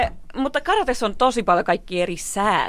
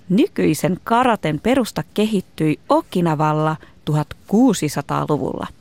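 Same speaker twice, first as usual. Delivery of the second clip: clear, projected presenter read; scripted explainer narration; 5 dB louder than usual. A narrator's reading. Host explains karate history to listeners, projected narration. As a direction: narrate it like a children's documentary.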